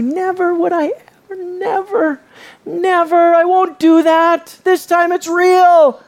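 Peter's emphatic protest; emphatic insisting. Retold or imitated. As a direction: insist desperately, voice climbing. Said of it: "Peter insists".

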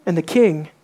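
usual speaking voice

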